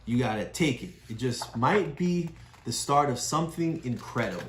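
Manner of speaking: ordinary delivery, nothing marked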